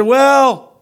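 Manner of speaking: high, loud, near-shouted exclamation; homeless man, delighted. Retold or imitated. The homeless man explains his generosity, high and shouting. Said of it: "He said".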